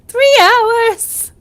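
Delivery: loud high exclamation; shout. Real, not acted. Shouted repeat.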